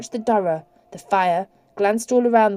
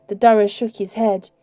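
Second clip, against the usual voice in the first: usual speaking voice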